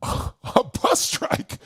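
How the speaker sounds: high, slow, breathy laugh-whisper of disbelief. Real, not acted. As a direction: echo it in stunned, laughing whisper. Disbelief at stranded night.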